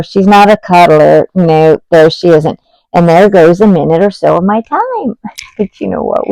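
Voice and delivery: ordinary talk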